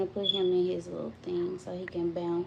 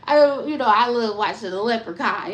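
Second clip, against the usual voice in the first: ordinary delivery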